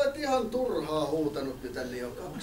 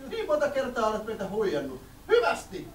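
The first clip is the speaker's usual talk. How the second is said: flustered villager woman; 6 semitones higher than usual. Acted. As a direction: squeal in a panic like a fussy villager. Annoyed villager.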